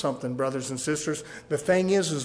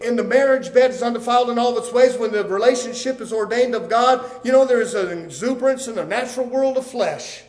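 raised, loud pulpit delivery; fervent preaching; 6 semitones higher, 8 dB louder than usual. Real, not acted. Preacher telling congregation of marriage's exuberance, fervent preaching. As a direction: drive it home with a higher, louder voice.

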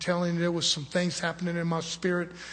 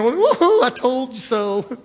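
very high, loud whooping crow; mock gloating; 7 semitones higher, 11 dB louder, slower than usual. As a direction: whoop it up as a hammed-up I-told-you-so. Imagined gloating by other brother.

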